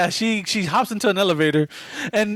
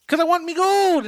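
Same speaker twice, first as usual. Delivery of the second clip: leprechaun voice; 10 semitones higher than usual. Retold or imitated. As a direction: squeak it like a greedy little leprechaun. Leprechaun voice quoted in retelling.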